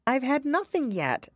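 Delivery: high, mildly indignant reply; offended. A narrator's reading. Alice answering the March Hare, offended. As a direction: protest with a touch of hurt dignity. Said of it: "replied in an offended tone".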